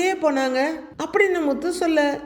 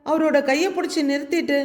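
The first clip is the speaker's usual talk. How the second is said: usual speaking voice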